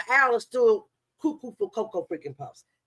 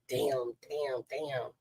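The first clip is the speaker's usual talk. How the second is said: much softer, lower self-reproach; regretful muttering; 8 semitones lower, 12 dB quieter, much slower than usual. Retold or imitated. Imagining the rival waking up regretful, muttering softly to herself. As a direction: mutter it, kicking yourself quietly. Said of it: "wakes up in the morning like".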